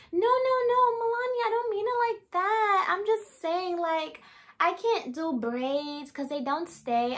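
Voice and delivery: very high, flustered backpedal, mimicked; put-on high voice, easing down as it explains; imitating Emily. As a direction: mimic a coworker protesting in a squeaky-high voice. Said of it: "this is how she talks".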